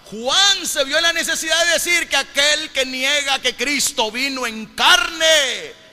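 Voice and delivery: loud, high proclamation with a drawn-out final word; impassioned pulpit delivery; full-force preaching. Real, not acted. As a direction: ring it out to the congregation.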